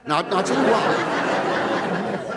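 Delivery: slow, teasing I'll tell you why; drawn out. Acted. Comic build to a punchline.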